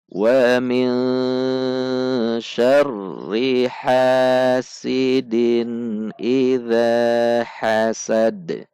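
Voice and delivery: slow chanted tajwid recitation with long held vowels; measured Quranic chant. Shown as a demonstration. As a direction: recite it slowly, stretching the long sounds. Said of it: "Mari kita baca".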